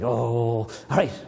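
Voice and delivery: slow, lilting poem recital; gentle recitation of Yeats; poem reading. Acted. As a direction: read it like a spell, slowly. Teacher reads poem with child.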